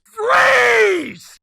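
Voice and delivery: sudden full-volume yell, very high; screamed command. Retold or imitated. Homeowner screaming at kids on the road, furious shout. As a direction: bellow it like an armed stranger. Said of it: "and screams".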